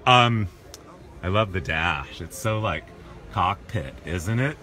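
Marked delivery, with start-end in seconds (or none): none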